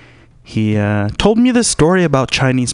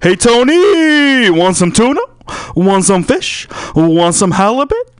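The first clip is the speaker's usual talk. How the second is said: shouting fish vendor; 11 semitones higher, 5 dB louder than usual. Retold or imitated. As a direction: bellow like a market vendor hawking seafood. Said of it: "The guy at the Swan Oyster Depot says".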